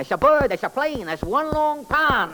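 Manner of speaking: sing-song comic detective character. Acted. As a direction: play the bumbling detective, chirpy. Detective boasting.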